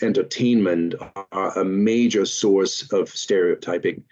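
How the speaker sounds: usual speaking voice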